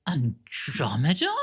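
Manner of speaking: ordinary talk, nothing marked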